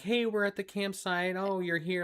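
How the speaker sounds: a hint of a cousin greeting someone; lightly voiced cheerful cousin. Cousin still talking to her late husband, lightly imitated.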